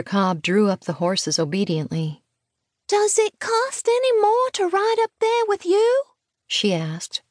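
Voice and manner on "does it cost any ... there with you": put-on very high, piping little girl's question; tiny high voice, politely enquiring; small child's voice. A narrator's reading. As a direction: ask a grown-up as a hopeful small child. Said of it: "he heard a small voice".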